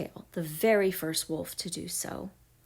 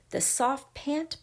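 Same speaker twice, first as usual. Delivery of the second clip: ordinary delivery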